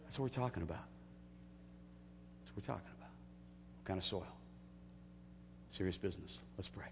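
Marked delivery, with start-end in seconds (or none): 2.4-3.1 whispered softly; whispered repeat; whispered echo. Shown as a demonstration. 3.1-4.2 slow whispered question. Real, not acted.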